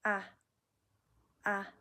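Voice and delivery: light short "ah"; neutral tone. Shown as a demonstration. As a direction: say "ah" light and quick. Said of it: "Neutral tone is light, short".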